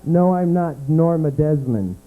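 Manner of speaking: ordinary talk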